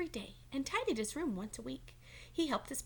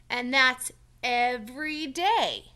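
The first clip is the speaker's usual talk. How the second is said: slow, much louder emphatic reminder; 2 semitones higher, 11 dB louder, much slower than usual. Acted. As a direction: lean on every word. Mister Mole reminding Franklin.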